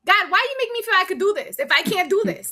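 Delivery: imitated desperate prayer; pleading with God. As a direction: cry out to God, half-mocking. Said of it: "This is what I would say".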